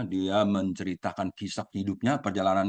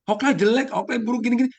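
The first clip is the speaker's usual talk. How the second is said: high, loud imitation of a taunter; mock badmouthing; 14 semitones higher, 6 dB louder than usual. Critics running down the speaker, mocking high voice. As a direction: jeer it high and loud, as someone else. Acting out people badmouthing him.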